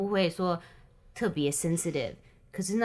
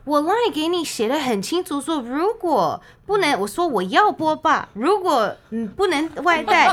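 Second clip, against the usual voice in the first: higher, louder indignant argument; indignant protest; 8 semitones higher, 6 dB louder than usual. Retold or imitated. Woman replays her indignant reply in the boba quarrel, louder. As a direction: argue your point hard.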